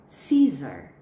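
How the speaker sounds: Caesar with a clear s sound, not like Caesarea. Shown as a demonstration. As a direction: say Caesar as see-zer. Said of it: "See the difference?".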